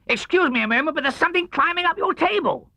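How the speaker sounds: high, quick, amazed Tigger voice; excited Tigger. A narrator's reading. Tigger tells Pooh about the mirror, high and excited. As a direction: Tigger thrilled at his own reflection.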